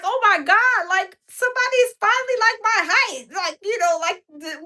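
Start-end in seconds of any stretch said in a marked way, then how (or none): 0.0-3.3 excited. Retold or imitated. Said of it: "so I was like".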